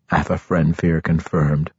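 ordinary delivery, nothing marked

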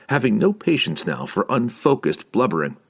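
ordinary delivery, nothing marked

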